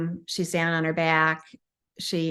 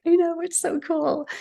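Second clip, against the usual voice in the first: bright, much higher, gushing; excited agreement; 10 semitones higher than usual. Retold or imitated. Healer telling the client about the lost time, giddy and high. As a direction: share the thrill, voice way up. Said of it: "I'm like".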